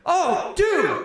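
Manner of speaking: very high, loud delighted yell; excited shout. Retold or imitated. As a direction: greet him like a long-lost friend. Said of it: "I was just like".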